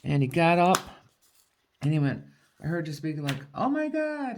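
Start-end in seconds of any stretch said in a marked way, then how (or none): 3.5-4.4 high, amazed gasp of words; a husband staring out at snow, amazed; excited exclaim. Retold or imitated. Retelling husband at window, high amazed exclaim.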